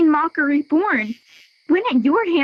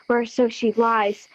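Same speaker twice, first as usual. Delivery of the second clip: ordinary talk, nothing marked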